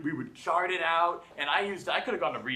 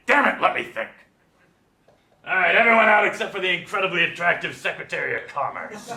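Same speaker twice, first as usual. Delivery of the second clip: mock president; 2 semitones higher, 5 dB louder than usual. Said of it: "You'd be like".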